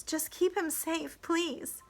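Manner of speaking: high, soft, slow request; hushed plea. A narrator's reading. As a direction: plead gently under your breath. Charm pleads quietly for the boy.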